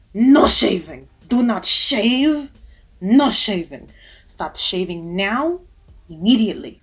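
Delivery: loud, emphatic ban, each word hit hard; passionate insistence. Real, not acted. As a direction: put your whole body behind the no. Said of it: "I stress this with all the passion in my body".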